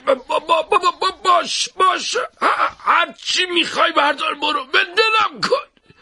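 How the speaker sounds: a man being choked, forcing out words; choking, broken words. Acted. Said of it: "بریده بریده و سخت گفت".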